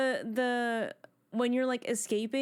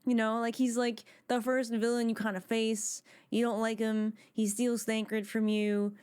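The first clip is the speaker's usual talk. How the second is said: normal talking voice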